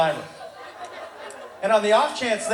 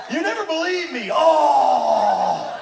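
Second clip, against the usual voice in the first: put-on exasperated teenager; 3 semitones higher, much slower than usual. Teenager protesting that dad never believes him, mock wail. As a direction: wail it like a wronged kid. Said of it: "what every teenager says at least once".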